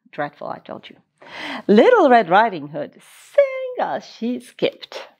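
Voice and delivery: ordinary delivery, nothing marked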